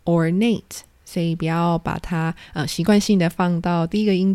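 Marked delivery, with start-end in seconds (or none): 0.1-0.8 ornate with the weight on nATE; stress on the last syllable. Shown as a demonstration. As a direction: lean on the second syllable of ornate. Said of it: "重音呢，是在后面的音节".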